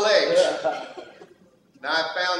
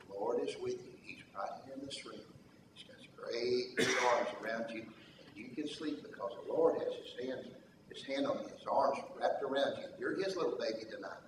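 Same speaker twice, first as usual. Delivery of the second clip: much softer and lower, near-whispered reassurance; hushed comfort; 6 semitones lower, 14 dB quieter than usual. Retold or imitated. Father reassuring his little daughter she is held, hushed. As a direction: whisper comfort close to a child's ear.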